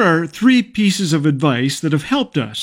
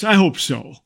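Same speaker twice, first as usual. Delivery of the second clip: ordinary talk, nothing marked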